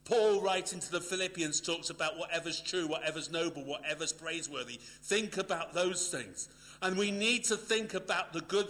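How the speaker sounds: loud, high, driving pulpit voice; forceful preaching. Acted. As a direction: preach it out to the hall with full force.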